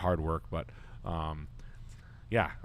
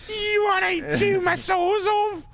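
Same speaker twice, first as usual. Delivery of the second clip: much higher, louder, slow leering falsetto of a crude nurse; 8 dB louder, much slower than usual. Retold or imitated. Mocking a crude American nurse hitting on the Aussie, high falsetto. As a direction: drag it out in a high lewd woman's voice.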